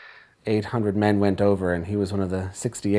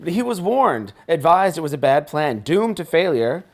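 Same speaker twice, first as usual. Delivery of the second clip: rising, heated indignation; angry; 8 semitones higher, 7 dB louder than usual. Acted. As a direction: build to a furious outburst. Caller's anger rising over Gallipoli.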